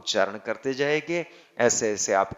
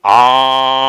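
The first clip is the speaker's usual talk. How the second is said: "आं" chanted with full force, much louder, held long; three times louder; 12 dB louder than usual. Shown as a demonstration. Teacher shows the full triple-strength Om, very loud chant. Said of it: "उसका तीन गुना".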